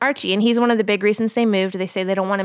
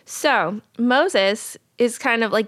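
normal talking voice

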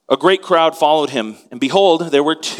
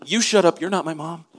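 sassy comeback; 3 semitones higher than usual. Retold or imitated. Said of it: "The blind men say".

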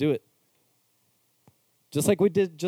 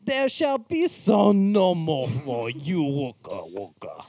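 Jabba the Hutt; 2 semitones higher, much slower than usual. Acted. Friend switches the refusal into a Jabba voice. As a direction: boom it like a slug gangster. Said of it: "Jabba the Hutt".

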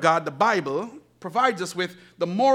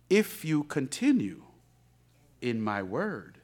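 slow, soft scripture reading, lower; quiet reverent reading; 9 semitones lower, 6 dB quieter, slower than usual. Acted. Pastor reads scripture softly to the church. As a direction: read it hushed and reverent.

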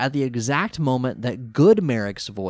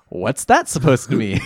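puzzled villain; 6 dB louder than usual. Retold or imitated. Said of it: "Merrick ruins it by saying".

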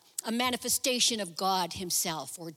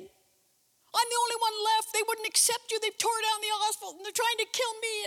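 higher, plaintive whining to God; whiny complaint; 12 semitones higher than usual. Pretend. Elijah complaining to God, high plaintive whine. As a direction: wail your grievances like a sulking prophet.

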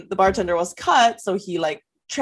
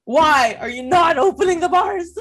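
mock demanding voice; 9 semitones higher, 4 dB louder than usual.

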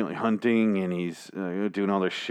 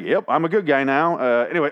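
higher-pitched, breezy fake innocence; mock nonchalance; 5 semitones higher, 4 dB louder than usual. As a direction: play the killer casually excusing himself. Mocking killer's breezy self-excuse.

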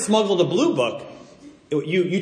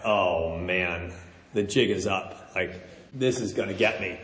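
low, much softer thinking-to-himself voice; dismayed inner thought; 11 semitones lower, 8 dB quieter than usual. Retold or imitated. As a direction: sink into a quiet, defeated aside. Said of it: "right away I thought".

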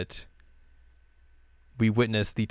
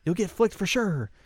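higher, sly urging; a higher-pitched, sly coaxing voice; scheming whisper-plan; 8 semitones higher than usual. Retold or imitated. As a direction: coax her to throw the game. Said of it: "she's like".

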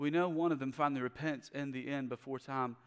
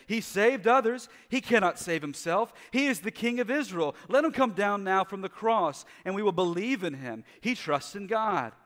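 loud, higher jeering taunts; mocking priests; 7 semitones higher, 10 dB louder than usual. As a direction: sneer it at the crowd. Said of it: "mocked him, saying".

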